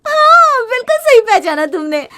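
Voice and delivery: a high, loud triumphant yes; bursting with pride over her purchase; proud and excited. Acted. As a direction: show off the new gadget with glee. Proud wife shows off machine.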